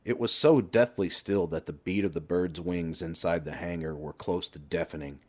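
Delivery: usual speaking voice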